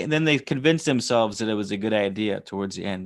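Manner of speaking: ordinary talk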